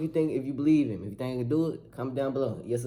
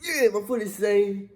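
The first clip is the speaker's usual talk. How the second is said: relieved excitement; 9 semitones higher than usual. Real, not acted. Vlogger discovering his camera footage saved, high excited relief. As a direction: pitch jumps way up in happy relief.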